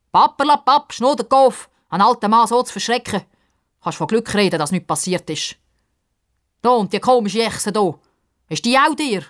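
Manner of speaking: loud, fast scolding; grumpy old man. A narrator's reading. Old man scolds Pippa, loud grumpy scolding. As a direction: scold the rude kid.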